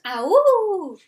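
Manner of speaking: loud, high howling yell; high wolf yell. Acted. Children yelling a howl at the wolf. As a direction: let out a wild howling cry. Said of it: "they yelled".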